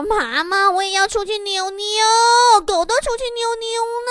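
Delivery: put-on high, childish wheedling; acting cute. Daughter begging mother for a walk like the dog, high pouty whine. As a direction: whine it high with stretched endings. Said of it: "我寻思我跟我妈撒撒娇".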